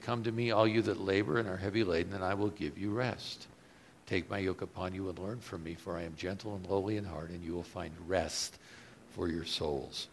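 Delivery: quiet, gentle reading of the verse; soft scripture reading. Acted. Preacher reading Jesus' invitation, soft and gentle. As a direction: read it low and tender like a promise.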